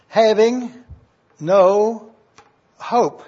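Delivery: "having" "no" "hope" each set apart by pauses; stress on having no hope. Shown as a demonstration. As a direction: lay weight on "having no hope", slowly, word by word. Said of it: "here's the word that I want you to see".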